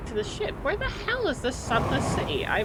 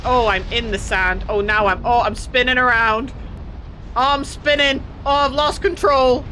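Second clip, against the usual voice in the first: loud, fast, flustered cries while crash-landing; loud panicky rambling; panicked; 2 semitones higher, 6 dB louder than usual. Real, not acted. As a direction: lose control and yelp about it. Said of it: "Oh, it's scary".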